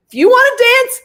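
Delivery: high, loud, eager retort; excited challenge. Retold or imitated. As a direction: throw down an enthusiastic dare. Acted enthusiastic reply to actor.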